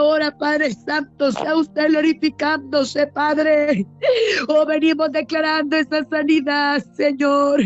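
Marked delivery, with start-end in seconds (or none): none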